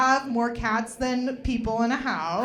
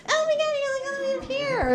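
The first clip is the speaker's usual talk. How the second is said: squeaky high pet voice, much higher; little pet voice; faster than usual. Retold or imitated. Owner doing a high little voice for her pet. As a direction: coo like a tiny pet talking. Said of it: "like, a little voice".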